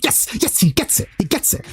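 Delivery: airy, hushed "yes, yes, he gets it"; delighted whisper. Retold or imitated. Quietly thrilled that a Tekken player finally gets it. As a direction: breathe it out in quiet glee. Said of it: "I'm like".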